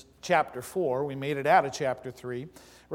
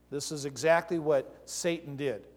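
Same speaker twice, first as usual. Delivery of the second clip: ordinary talk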